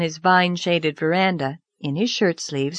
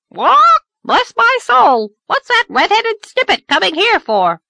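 shrill high squawk, loud and rasping; squawking parrot; 8 dB louder than usual. Acted. Parrot squawks at Anne as she sits down, high loud squawk. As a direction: screech it like a cheeky parrot. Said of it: "Ginger exclaimed".

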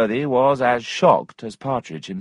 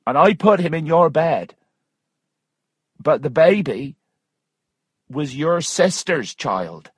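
higher-pitched woman revealing a secret; firm, raised character voice; insistent Missus Waters; 7 semitones higher, 3 dB louder than usual. Acted. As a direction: pitch it up for the revelation.

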